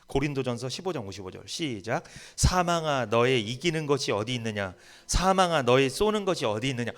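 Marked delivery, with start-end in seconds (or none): 2.4-6.9 loud, strong scripture reading; forceful reading. Shown as a demonstration. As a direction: read it out with power. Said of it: "우리 힘있게 읽어보겠습니다".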